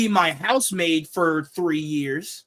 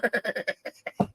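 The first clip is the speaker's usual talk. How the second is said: breaks into a quiet laugh mid-joke; laughs, can't keep a straight face; burst of laughter; 9 dB quieter than usual. Real, not acted. Laughing at his own wild answer to the listener. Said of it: "I couldn't say that with a straight face".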